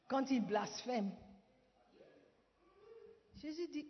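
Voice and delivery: ordinary talk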